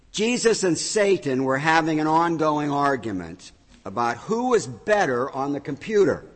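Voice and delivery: ordinary delivery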